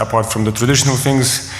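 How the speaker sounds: ordinary delivery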